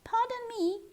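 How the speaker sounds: plain reading voice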